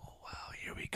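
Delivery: breathy whispered here we go; whispered aside. Retold or imitated. Bracing for trouble, whispered aside. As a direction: whisper it to yourself.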